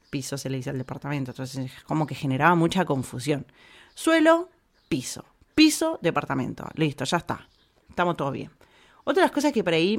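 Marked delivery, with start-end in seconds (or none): 3.9-4.5 suelo called out much higher, rising; high exclaimed suelo. Shown as a demonstration. Saying suelo the Spanish way, called out high. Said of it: "yo ahora ya me acostumbré y le digo suelo". 4.9-6.0 whispered. Shown as a demonstration. Listing the confusing words.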